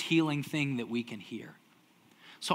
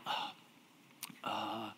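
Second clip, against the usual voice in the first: awkward hesitation; 8 semitones lower, 18 dB quieter than usual. Retold or imitated. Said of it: "They're kinda like".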